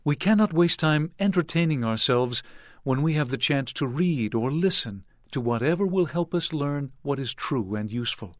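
usual speaking voice

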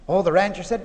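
normal talking voice

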